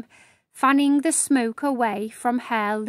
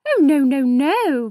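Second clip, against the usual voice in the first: louder, emphatic refusal; protesting; 5 dB louder than usual. Acted. Madame Miraculous refusing to clean the mess herself, loud protest. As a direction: wave the idea away firmly. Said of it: "Madame Miraculous protested".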